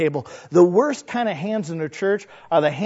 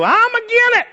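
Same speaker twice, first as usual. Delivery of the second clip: much higher, louder contrary put-on voice; Brother I'm Agin It; 6 dB louder than usual. Church naysayer rejecting every plan, high and loud. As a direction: object to everything in a high stubborn squawk. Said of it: "a man in a church called Brother I'm Agin It".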